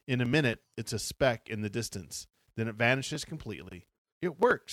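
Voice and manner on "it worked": excited cry. A narrator's reading. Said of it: "Stacy cries".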